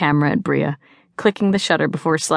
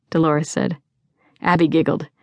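usual speaking voice

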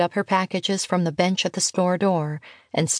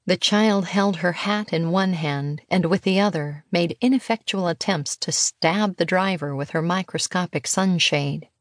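plain reading voice

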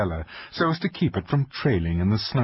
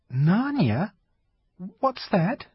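put-on young girl voice; 11 semitones higher, slower than usual. Lucy puzzled question.

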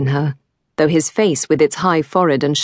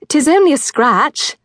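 high, airy dismissal; brushing it off; 10 semitones higher, 3 dB louder than usual. Acted. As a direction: shrug it off brightly. Girl brushing off injury.

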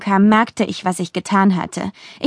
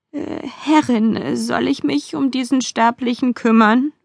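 higher, weary servant voice; tired resignation; 4 semitones higher than usual. A narrator's reading. Demon servant offering to handle a mortal, wearily resigned. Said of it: "mit müder Resignation in der Stimme".

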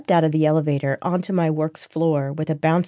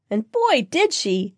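gushing; 7 semitones higher, slower than usual. Acted. Friend gushes over her story.

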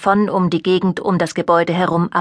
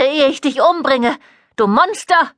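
higher, rising to a shriek; 7 semitones higher than usual. A narrator's reading. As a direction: screech the threat in terror. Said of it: "Ihr Ton steigerte sich zu einem Kreischen". (Mutter kreischt ihren Sohn verängstigt an)

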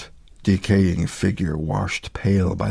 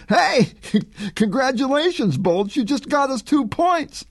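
high, laughing sarcastic jeer; mocking taunt. A narrator's reading. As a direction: rub it in with a laugh. Opponent mocks the fallen quarterback.

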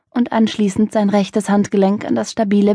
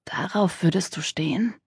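hoarse whisper; 9 dB quieter than usual. A narrator's reading. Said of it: "mit rauer Stimme flüstern musste".

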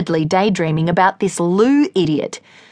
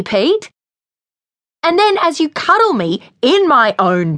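furious tirade; 7 semitones higher, 4 dB louder than usual. Acted. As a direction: tear into him, voice climbing high. Lily's furious tirade at Pete.

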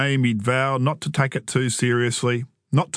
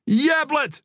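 put-on very high, fierce kid's cry; high-pitched indignant shout; 14 semitones higher than usual. A narrator's reading. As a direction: yell the name in protest. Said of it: "Joel shouted, his eyes ablaze".

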